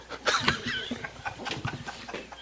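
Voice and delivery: breathy laugh at himself; a long, helpless chuckle. Real, not acted. Pastor amused that the preacher was himself, laughing. Laughs at his own story.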